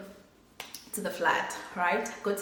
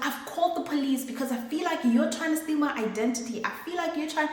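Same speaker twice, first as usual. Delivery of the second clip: higher, fast accusing voice; mock angry mother; 7 semitones higher, faster than usual. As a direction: fire off the threat pitched up and fast. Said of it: "she gives me a show".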